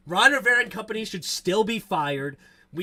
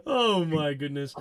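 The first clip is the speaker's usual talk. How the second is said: ordinary talk, nothing marked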